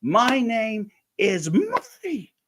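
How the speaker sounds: high, slow, insistent; stubborn in-character reply. Retold or imitated. Stoltz answering Spielberg, high and slow, staying in character. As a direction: spell out the name like it's obvious. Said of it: "turns and says".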